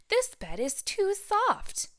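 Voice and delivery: high, slow, fussy penguin voice; Tacky voice. Acted. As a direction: stretch it out, high and picky. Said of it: "said Tacky Locks".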